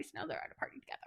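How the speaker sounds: hushed conspiratorial whisper. Real, not acted. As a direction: drop to a secret whisper. Whispered aside.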